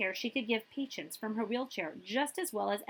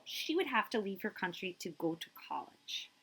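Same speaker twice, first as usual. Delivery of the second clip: plain reading voice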